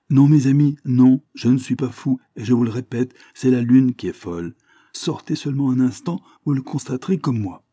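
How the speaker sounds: loud, high, pressing protest; insistent conviction. Acted. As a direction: insist with full conviction that he is not mad. Gabarit insists he is not mad.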